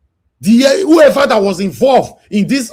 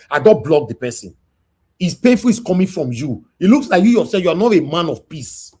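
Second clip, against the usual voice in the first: ordinary talk, nothing marked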